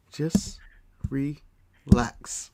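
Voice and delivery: soft whispered relax; ASMR whisper. Pretend. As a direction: whisper it to calm them. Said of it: "That's not relaxing".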